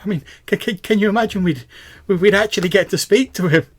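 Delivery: high, loud, stammering gush; excited young fan. Acted. Young fan pleads to meet her fighter idol, excited and high. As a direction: gush breathlessly about an idol, pitch way up.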